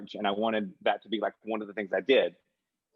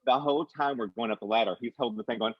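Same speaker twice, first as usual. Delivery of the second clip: normal talking voice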